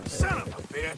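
loud, high burst of swearing; angry curse. Acted. Curses during game scene.

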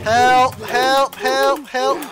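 loud repeated cry for help; calling for help. Real, not acted.

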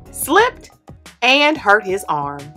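ordinary delivery

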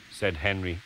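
ordinary delivery, nothing marked

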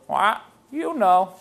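slow parrot squawk, then a knowing line; squawk, then drawl it out as the parrot. Acted. Parrot to the lady after being warned, slow parrot voice. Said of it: "The parrot goes".

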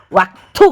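loud, low retching "walk, থু" in disgust; a contemptuous spitting noise; disgusted spit sound. Real, not acted. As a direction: spit the words out like you're gagging.

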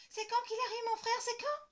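put-on high, loud impatient child; girl asking over and over. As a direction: pester about the brother. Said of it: "répète Lola toute la journée".

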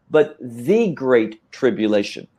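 THE said as a long, full "thee"; stress on THE. Real, not acted. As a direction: lean on THE, setting it apart before "great tribulation". Contrast: phrase with the article.

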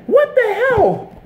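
high, slow, drawn-out disbelief; exasperated. Real, not acted. Fan fed up with the referees, slow incredulous high cry. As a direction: throw up your hands at the refs.

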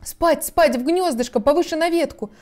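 high-pitched, fussing bird call; trembling singsong canary. A narrator's reading. Canary sends children to the nest, trembling singsong. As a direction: hurry the chicks in a high lilt. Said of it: "запела она дрожащим голоском".

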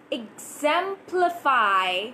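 "exemplify" stretched slowly, rising at end; slow drill. Shown as a demonstration. Teacher drilling "exemplify" for learners, slow. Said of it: "repeat these words one more time".

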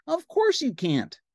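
very high cartoon pal; Ernie. Ernie agrees with Bert, high cartoon voice. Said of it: "said Ernie".

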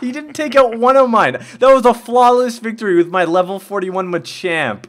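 high, loud laughing brag; excited bragging. Real, not acted. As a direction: gloat with a laugh. Bragging after flawless victory.

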